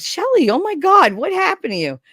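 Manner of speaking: high, loud mock alarm; shocked cry easing down at the end; retold shock. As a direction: react with big alarmed surprise, imitating a colleague. Retold shock at seeing her injury.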